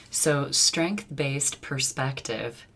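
very slow, spelled-out phrase; slow careful. Shown as a demonstration. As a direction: take it slowly to avoid slurring. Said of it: "is always a shmush-mush".

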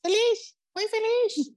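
very high squeaky falsetto. Retold or imitated. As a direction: squeak it up like a whistle. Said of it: "Este puñetas con voz de pito".

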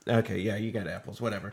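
low, soft, dismissive shrug; not caring. Acted. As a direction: act like you've given up caring. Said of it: "like, don't care".

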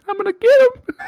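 shrieking cowboy gone wild; crazy high-pitched voice. Retold or imitated. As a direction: squeal like a crazed madman. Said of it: "his crazy person high-pitched voice".

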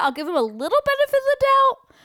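a sudden falsetto grudging allowance; squeaky mock concession. Conceding to a friend about a film, squeaky high voice. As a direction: jump way up high in a squeaky put-on voice.

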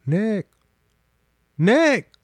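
high call across the backyard; calling a name. Retold or imitated. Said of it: "So I hear".

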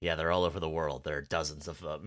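low, soft-spoken disdain. Retold or imitated. Imitating a character unhappy about Cupids, low and disdainful. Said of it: "like, disdain in his voice when he talks about them".

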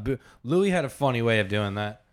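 ordinary delivery

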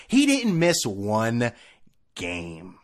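slow, pointed delivery with a hushed last word; emphatic. Real, not acted. Hammering the point.

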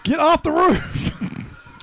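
slow, high yell; drawn-out parent yell; shouting up. Acted. Parent yelling at kid.

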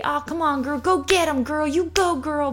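high, bright-voiced you-go-girl cheer; cheerleading hype. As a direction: hype her up with fake enthusiasm. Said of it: "we might say".

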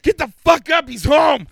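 high frantic yell; rudely awakened. Retold or imitated. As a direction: scream it to wake someone. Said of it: "rudely awakened by".

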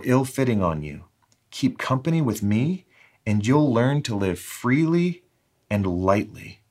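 lifted, emphatic reading with spaced phrases; deliberate, projected devotional reading; expressive scripture paraphrase reading. Acted. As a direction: read it out as a direct invitation, pausing after each phrase. Reads a paraphrase as Jesus speaking.

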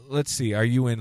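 ordinary talk, nothing marked

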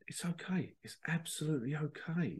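soft, half-whispered soothing advisor; calm the king under your breath; hushed reassurance. Acted. Advisor reassuring a panicking king, hushed and soothing.

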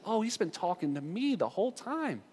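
soft, high-pitched dawning wonder; hushed realization. Acted. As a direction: realize it quietly, voice lifting high.

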